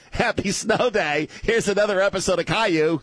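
put-on high, sing-song children's presenter voice; mock kids' TV host. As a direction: parody a perky public TV host.

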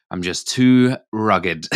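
slow, laughing mock brag; mock-serious laugh. Real, not acted. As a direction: drag it out while laughing. Mock-boasting joke about looking older.

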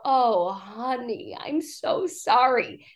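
slow, gentle sympathy; sympathetic comfort. Retold or imitated. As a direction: comfort a friend tenderly.

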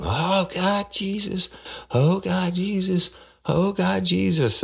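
hammed-up shrieky pleading, far above own voice; mock screaming. Arrested woman cries out as she is cuffed, mock screaming. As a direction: imitate her in a very high falsetto wail. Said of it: "she starts screaming".

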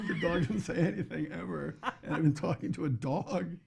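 put-on high, laughing kid's realization. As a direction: giggle through the realization. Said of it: "eruption of awareness laughter".

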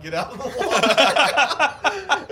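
high, loud, drawn-out yell, laughing; imitated shouted order. Lifeguards clearing the pool, mock panicked yell. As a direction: holler it slowly across a pool.

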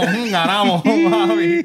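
high, teasing purr on papi; mock flirty voice. Imitating the partner gloating to the crowd, mock flirty. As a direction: camp it up like a smug lover. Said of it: "el otro dijo".

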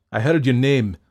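loud, impassioned dismissal; forceful denunciation. A narrator's reading. Fettes ordering the doctor out, impassioned denunciation. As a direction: declaim it and drive him away.

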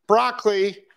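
a high, loud called "Broccoli"; loud yell. Retold or imitated. Retelling calling his dogs from far off, loud yell. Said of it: "really loud".

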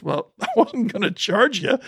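high, amused and easygoing, laughing through it; laughing cowboy. Retold or imitated. Young cowboy laughing off the idea of charging. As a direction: chuckle it off like it's obvious. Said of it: "And the guy goes".

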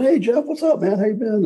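high-pitched retold hello to an old friend; delighted greeting. Retelling his greeting to Jeff after years, delighted. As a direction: greet a long-lost buddy, pitched well up.

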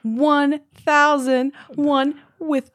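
happy outburst over news; excited. Real, not acted. Thrilled at thousand subscribers.

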